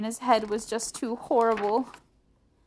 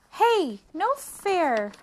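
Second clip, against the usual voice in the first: high, loud, drawn-out whine of complaint; indignant protest; 11 semitones higher, 7 dB louder, much slower than usual. A narrator's reading. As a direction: protest like a wronged little sister. Sister protests the sleeping arrangement.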